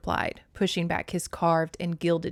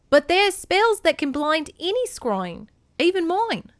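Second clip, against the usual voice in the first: put-on high, loud Australian-accented goddess; 11 semitones higher, 7 dB louder than usual. A narrator's reading. Hera offers to scry for Kor, Australian accent. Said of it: "gave Hera an Australian accent".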